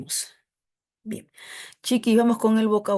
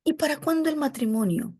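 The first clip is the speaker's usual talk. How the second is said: nosy relative; 7 semitones higher, faster than usual. Retold or imitated. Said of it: "Me dijo".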